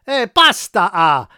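high, loud hammed-up Italian accent with a tacked-on "ah"; mock Italian accent. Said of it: "Italian accents".

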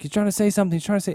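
high, urgent voice; mock panicked. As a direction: act out a panicked helper. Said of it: "He's like".

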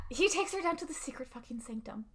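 hushed, mock-secret aside; conspiratorial whisper. Real, not acted. Recapping the show to her cohost, conspiratorial whisper.

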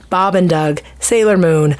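ordinary delivery

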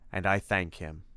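understated formal hero; Simon, sober gratitude. Acted. Simon credits Tim with helping him, sober gratitude. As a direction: give thanks gravely and evenly.

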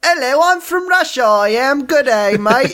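high, hammed-up Russian accent sliding into Aussie; bad Russian accent. Joking about the new Russian girlfriend in a bad Russian accent. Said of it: "new Russian girlfriend".

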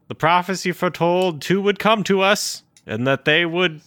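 high, loud wise elder voice; prophesy like a tiny wise master; Yoda voice. Retold or imitated. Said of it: "Full on Yoda".

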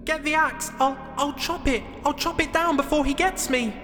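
high, stammering falsetto plea; urgent mock yell. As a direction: shout down desperately in a small squeaky voice. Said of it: "yelled Jack".

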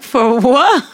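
laughing disbelief. Retold or imitated. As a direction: replay a baffled comeback, cracking up. Retells her baffled reply, laughing.

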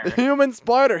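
loud, high mock self-introduction; hammed-up name reveal; retold ring name. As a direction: proudly announce a silly stage name. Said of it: "And he's like".

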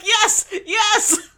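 high, cheering yeses; mock triumph. Wong celebrating the explosion, high mock cheer. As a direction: imitate a gloating villain's cheer. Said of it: "He's like".

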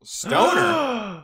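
high-pitched shocked echo with a gasp; hammed-up astonishment; mock gasp. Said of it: "You all gasp".

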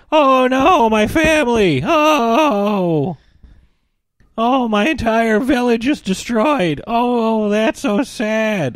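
high, drawn-out fake sobbing lament; mock wailing. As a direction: wail like a whiny teen, hammed-up. Mocks Hiccup lamenting his destroyed village.

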